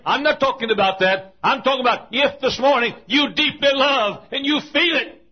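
loud, high preaching push; fervent preaching. Real, not acted. Preacher pressing the congregation about tears. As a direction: drive it home to the pews.

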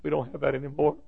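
soft, subdued imitation of crying; retold tearful voice. Custodian telling the preacher of empty years, softly tearful. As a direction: drop softly, as if close to tears. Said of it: "then he began to cry".

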